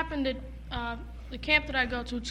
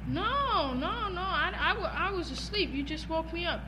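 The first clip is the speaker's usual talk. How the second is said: protesting camper; 4 semitones higher than usual. Retold or imitated. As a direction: act out a boy's innocent denial, pitched up. Retold boy's sleepy protest, acted.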